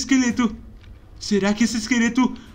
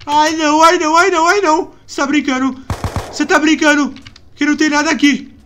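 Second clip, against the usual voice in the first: louder, rattled disbelief; agitated disbelief; 4 semitones higher, 6 dB louder than usual. Real, not acted. Rattled gamer finding the monster gone. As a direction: protest in frightened disbelief.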